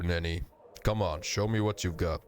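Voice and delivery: usual speaking voice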